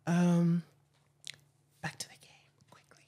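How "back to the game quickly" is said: whisper. Real, not acted. Host whispers a nudge.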